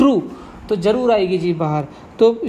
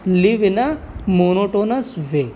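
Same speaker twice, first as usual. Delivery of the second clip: slow dictation; much slower than usual. Shown as a demonstration. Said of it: "कैसी life जी रहे थे?".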